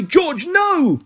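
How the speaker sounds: high, strained cry to stop him; pleading shout. Acted. Teammate begging George to stop, high shout. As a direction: yell to hold him back. Girl screams at fight on pitch.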